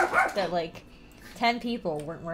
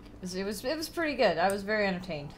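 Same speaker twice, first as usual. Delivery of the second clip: ordinary talk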